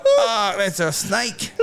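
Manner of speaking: mock shocked shout. Shocked reaction retold in car.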